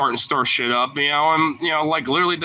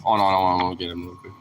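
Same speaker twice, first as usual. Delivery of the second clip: ordinary talk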